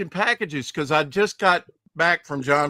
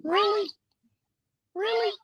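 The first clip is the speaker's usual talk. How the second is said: high squeaky helium voice; voice changer. Pretend. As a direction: chipmunk voice changer. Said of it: "You sound like Shane".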